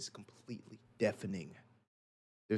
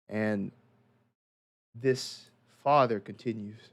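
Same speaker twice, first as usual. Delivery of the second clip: ordinary talk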